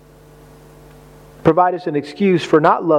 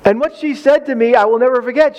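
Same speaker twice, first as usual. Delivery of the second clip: normal talking voice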